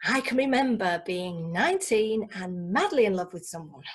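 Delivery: ordinary delivery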